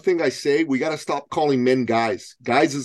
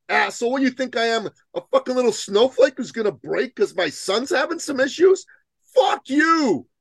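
high-pitched, acted outrage; indignant retort; 10 semitones higher than usual. Man telling off the dude who pitied him, indignant. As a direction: tear into him with mock-furious indignation.

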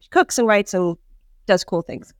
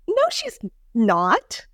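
slow, higher drawn-out denial; retold firm denial; 8 semitones higher, much slower than usual. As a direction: stretch out a disbelieving no. Her retold reaction to accusation.

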